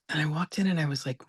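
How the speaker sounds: ordinary talk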